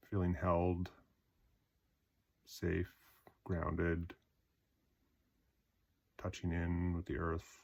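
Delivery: short phrases, each left to settle; guided meditation. Acted. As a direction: guide them through a body scan, unhurried. Guided body scan meditation.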